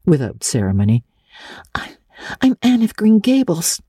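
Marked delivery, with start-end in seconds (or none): 1.7-3.8 put-on high, hesitant girl's voice with a stammer; a small girl speaking shakily, half afraid; tremulous little girl. A narrator's reading. Small visitor nervously answers the old lady, trembling.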